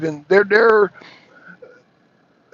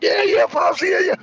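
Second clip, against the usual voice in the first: high-pitched mock agreement; humouring Pops; 12 semitones higher than usual. Streamer pretending to follow what Pops says, high humouring yeahs. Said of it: "I'm like".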